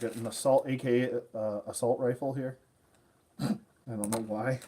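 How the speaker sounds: ordinary delivery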